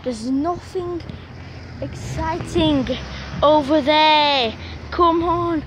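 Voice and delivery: losing patience with the dog, loud and slow; exasperated loud plea. Real, not acted. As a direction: stretch the words out, getting cross. Frustrated at dog pulling.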